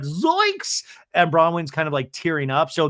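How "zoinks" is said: shocked high squeak; a cartoonish gasp of shock, very high; host voices Lisa's shocked face, very high yelp; Zoinks. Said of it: "Lisa's face is like".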